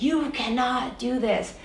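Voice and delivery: pleading insistence, voice lifted as in a retelling; retold protest. Retells her protest during the fight.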